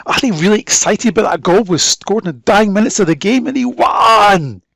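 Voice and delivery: loud, high, fast excited rush; shouting with excitement over a last-minute winner. Shown as a demonstration. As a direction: blurt it out thrilled about the late goal. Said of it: "The speed of conversations is quite important".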